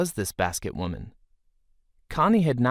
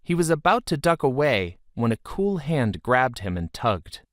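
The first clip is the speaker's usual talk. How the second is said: ordinary delivery, nothing marked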